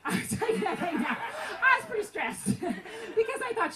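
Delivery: laughing through the words. Real, not acted. Telling the congregation how stressed she was, laughing. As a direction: laugh at your own nerves.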